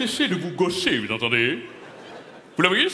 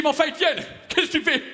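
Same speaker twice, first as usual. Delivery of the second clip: much higher, half-shouted reproach; retold outburst; 12 semitones higher, faster than usual. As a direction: shout at the actor on stage. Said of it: "Je lui dis".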